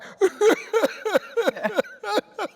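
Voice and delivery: high, loud put-on wailing; demonstrated fake crying. Shown as a demonstration. Director showing actor how to cry on cue, fake wailing. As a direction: burst into staged sobs, very high-pitched. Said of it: "I'm gonna cry".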